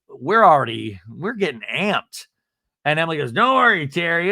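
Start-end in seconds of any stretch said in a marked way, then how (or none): 3.3-4.3 high, loud, breezy imitation; mock reassurance. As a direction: brush off the worry in a raised voice. Imitating Emily brushing off Terry.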